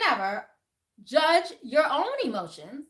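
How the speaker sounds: usual speaking voice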